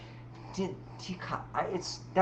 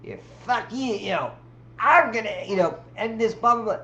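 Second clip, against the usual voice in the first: higher, half-hearted mock rant; mock lashing out; 6 semitones higher, 5 dB louder than usual. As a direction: rant like a threatened diva. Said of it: "it goes".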